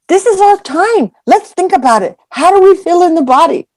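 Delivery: a hint of preachy teacher; mock earnest monk. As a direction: parody his gentle coaching. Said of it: "he's just like".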